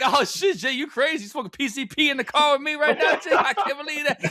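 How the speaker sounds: hammed-up high freak-out, half laughing; mock hysteria, loud and way up in pitch; Denzel Washington. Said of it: "he's just freaking the fuck out".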